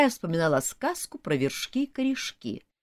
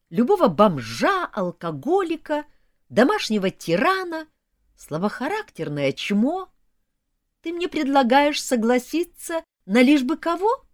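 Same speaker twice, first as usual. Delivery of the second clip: higher, louder heated rant; indignant outburst; 6 semitones higher, 6 dB louder than usual. Acted. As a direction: rant back, indignant and loud. Heroine's indignant outburst at demon.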